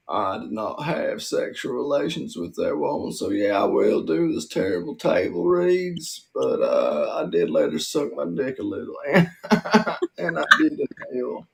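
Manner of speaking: deny, then confess like a roguish ex-president; Bill Clinton. Acted. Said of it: "one of the characters as Bill Clinton".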